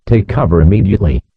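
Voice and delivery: normal talking voice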